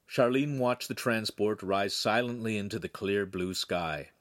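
ordinary delivery

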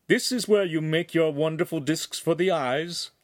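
disdainful noble. Acted. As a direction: ask it as a lord looking down on tradesmen. Noble mocks the lensmaker's shop.

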